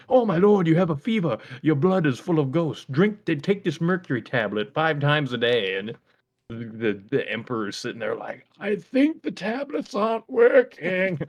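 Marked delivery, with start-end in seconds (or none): 0.1-5.7 hammed-up English-accented doctor, high and loud; mock English accent, raised and urgent. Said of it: "he had an English accent". 8.6-11.2 high, loud, slow mock emperor; hammed-up royal patient; doubtful emperor. Acted ailing emperor doubting doctor.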